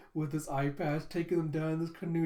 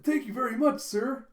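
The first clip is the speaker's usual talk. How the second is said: high mock gratitude; 9 semitones higher, 7 dB louder than usual. Flunkies thank the teacher.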